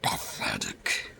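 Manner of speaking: very low slow whispered sneer; scornful toy voice. Pretend. The toy sneers at the reviewer's voice, very low slow. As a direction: drop it deep and drag out the scorn. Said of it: "That's the best voice you can give me".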